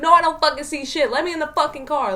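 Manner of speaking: loud, high, rapid angry outburst; retold screaming. Locked out of the car yelling at friend, screaming. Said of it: "I'm sitting here screaming".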